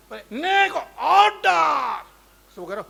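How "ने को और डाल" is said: very slow shout, higher and louder; drawn-out shout. Acted. Mourner shouts at the priest for more ghee.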